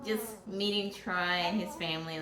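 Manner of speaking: normal talking voice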